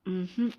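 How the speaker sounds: a tired little mm-mm; hummed no. A narrator's reading. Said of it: "ഒന്നുമില്ല എന്ന രീതിയിൽ ഒരു മൂളലായിരുന്നു". (ഇല്ലെന്ന മട്ടിൽ പതിഞ്ഞ മൂളൽ)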